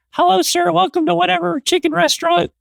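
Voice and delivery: put-on high, thin voice of a nervous young cashier; little guy at the register greets the customer, high and timid. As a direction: squeak out a polite counter greeting. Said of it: "guy behind the register is like".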